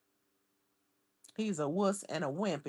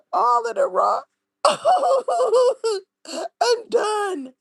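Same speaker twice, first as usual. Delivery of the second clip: much higher, louder, squealing laughs; helpless laughter; 11 dB louder than usual. Real, not acted. Cracking up over the photo, helpless laughter. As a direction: lose it completely.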